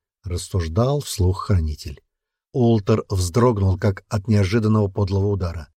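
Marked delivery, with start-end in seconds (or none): none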